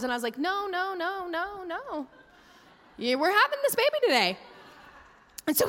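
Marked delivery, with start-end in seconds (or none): 0.4-4.4 higher, insistent mock no's, fading then firm; mock refusal. Mom telling her doctor no, mock insistent. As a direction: playfully refuse the long labor. Refusing a thirty-hour labor.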